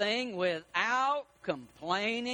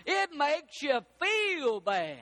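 shouted sermon point; 5 semitones higher, slower than usual. Acted. Preacher answering his own question to the church, shouting. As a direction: drive the point home with a shout. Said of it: "preaching emphasis, shout".

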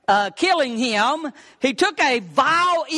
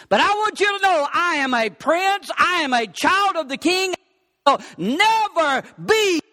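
proud, higher-pitched declaration building in force; defiant young prince; 5 semitones higher than usual. Acted. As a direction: stand up to your captor, slowing on the last vow. Prince defies his captor in story.

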